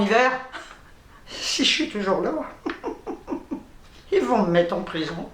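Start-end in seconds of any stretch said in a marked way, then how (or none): 2.3-3.6 breathless silent laugh. Real, not acted. Joking about prison.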